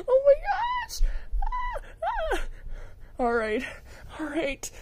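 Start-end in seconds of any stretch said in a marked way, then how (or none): 0.1-2.4 overwhelmed fan squeal. Acted.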